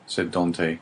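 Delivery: usual speaking voice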